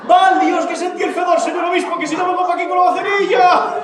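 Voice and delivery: high, shouted comic outburst; loud exclaiming relief. Acted. Manolona tells the bishop of her mix-up, loud exclaiming relief. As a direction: blurt it out at full voice, very high.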